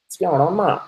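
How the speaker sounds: broad Bogan Aussie; Bogan accent. Shown as a demonstration. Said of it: "That's a real Bogan way of saying it".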